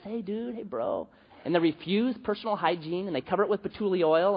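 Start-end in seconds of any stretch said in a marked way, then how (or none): 0.0-1.1 a lazy, laid-back stoner voice; mock hippie drawl. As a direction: play the spaced-out hippie. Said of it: "they talk like this".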